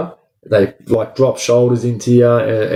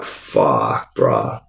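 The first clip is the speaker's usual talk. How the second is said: much lower, softer, sinking groan of dismay; 13 semitones lower, 5 dB quieter than usual. Retold or imitated. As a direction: let the words drop with dread. Reaction to hearing about escort.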